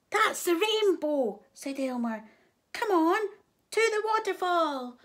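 high, loud rallying cry; rally everyone with eager urgency; excited Elmer. A narrator's reading. Elmer realises where the rainbow is, excited.

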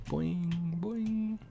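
rising high boing noises; bouncy cartoon boing; game jump sound. Real, not acted. Jumping in game.

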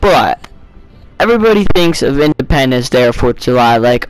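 ordinary talk

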